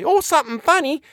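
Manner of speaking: very high-pitched, flustered housekeeper; excited cry. A narrator's reading. Housekeeper reporting burglars to the doctor, excited cry. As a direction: cry out the news in a high put-on voice. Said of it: "she cried excitedly".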